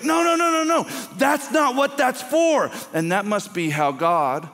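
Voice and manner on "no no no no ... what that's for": much higher, louder pleading no; anguished cry. Pretend. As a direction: cry out in dismay at the misuse. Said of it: "the cry of your heart is like".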